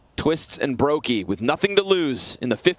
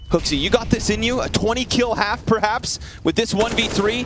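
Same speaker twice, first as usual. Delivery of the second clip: high hyped play-by-play; esports caster; 7 semitones higher than usual. Real, not acted. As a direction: cheer the player on.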